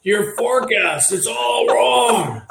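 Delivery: high, slow, half-laughing booming scold; Felix Beck voice. Retold or imitated. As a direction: bark it out high and loud with a laugh. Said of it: "I'll butcher his voice".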